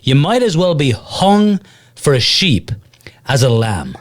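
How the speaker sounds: put-on measured, unhurried delivery of an old expression; slow and deliberate. Shown as a demonstration. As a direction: spell out the saying slowly, with pauses. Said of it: "you're gonna have to say 'em slow".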